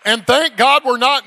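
loud, high revival preaching; full-force preaching. Acted. Evangelist firing up the church, preaching loud and high. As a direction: rouse the congregation, loud and high.

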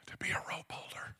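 hushed, whispered key phrase; solemn whisper. Real, not acted. Preacher telling church what the girl knew, solemn whisper. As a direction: drop to a whisper for weight.

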